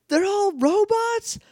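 very high, slow disbelief; stunned Justin. Retold or imitated. Justin learning everyone is a robot, high slow disbelief. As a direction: ask it in shrill mock shock. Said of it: "And then he's like".

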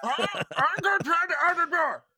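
put-on high loud cranky old-timer; holler, fed up with doors; old prospector. Old man NPC yelling.

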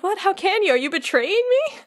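high, mock-distraught protest. Replica Riku asking if he is being betrayed, distraught. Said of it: "looks really distraught".

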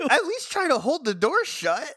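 high-pitched, incredulous protest; exasperated. Real, not acted. Co-host scolding the useless Rangers on screen, exasperated. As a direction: plead with them in disbelief.

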